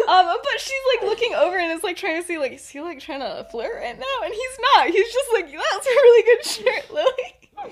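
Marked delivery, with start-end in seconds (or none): 5.5-7.4 laughing compliment. Retold or imitated. James innocently praising her shirt.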